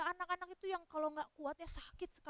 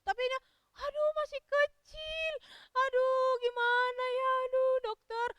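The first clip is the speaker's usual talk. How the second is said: high, loud, drawn-out wail; tearful lament; 8 semitones higher, 7 dB louder than usual. Acted. Distraught parent laments the small child to doctor, wailing. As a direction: lament in a hammed-up high sob. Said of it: "Papanya juga nangis".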